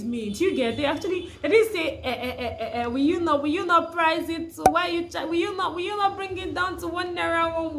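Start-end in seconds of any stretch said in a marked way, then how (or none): none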